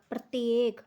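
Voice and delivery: ordinary talk, nothing marked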